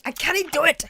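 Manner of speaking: high, loud put-on cry; mock exasperated character voice. Failed mimic gives up on the accent, mock exasperated cry. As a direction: burst out in a comic character voice.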